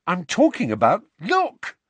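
rising to a high urgent cry. Acted. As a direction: point and urge him to look. Harry urges Ron to look.

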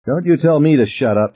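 mildly acted indignation; indignant retort. A narrator's reading. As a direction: stand up to the one yelling. Ann retorts to Ted's yell.

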